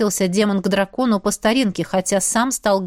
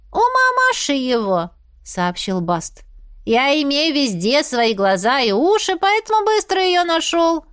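high, swooping cat voice; cat character, boastful; 6 semitones higher than usual. Acted. Bast bragging how he found her, self-satisfied cat. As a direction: boast as a talking cat, pitch climbing.

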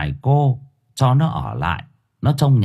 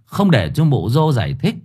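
ordinary delivery, nothing marked

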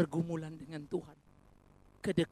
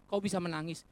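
usual speaking voice

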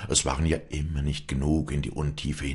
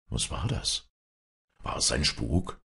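softer, startled questioning; hushed alarm; 3 semitones lower, 5 dB quieter than usual. A narrator's reading. Walli hears a ghostly cry below, hushed and startled. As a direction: ask warily, voice dropped in volume.

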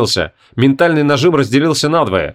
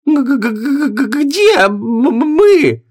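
high terrified stammering; frightened stutter; 12 semitones higher, much slower than usual. A narrator's reading. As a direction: stammer in panic, pitch up. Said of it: "пробормотала Гвиньола, заикаясь".

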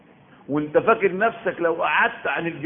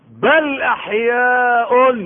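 very slow, high, loud chanted "بل احياء"; Quranic recitation; 7 semitones higher, 6 dB louder, much slower than usual. Acted. Preacher recites the verse to the congregation, chanted. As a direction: intone it like tajweed.

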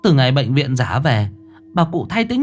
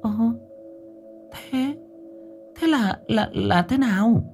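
put-on high, stammering, fearful old woman; frightened stammer; 12 semitones higher, 7 dB quieter than usual. Wife asks husband in fright, high stammering voice. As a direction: stutter out a frightened question. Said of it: "bà The kinh hãi".

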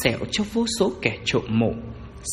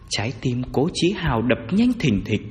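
ordinary delivery

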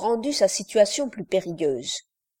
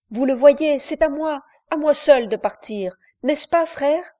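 higher, urgent plea to his brother; insist, voice lifted high; boy begging his brother to let him go; pleading insistence; 5 semitones higher, 5 dB louder than usual. Acted. Jacques begs to go alone.

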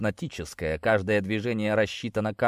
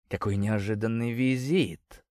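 femme fatale purring a greeting. Acted. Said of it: "промурлыкала Селия".

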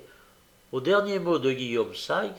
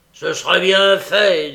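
loud, higher, rough-tempered retort; angry retort; 5 semitones higher, 11 dB louder than usual. A narrator's reading. Sykes fights off Nancy.

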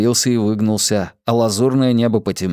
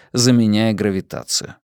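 plain reading voice

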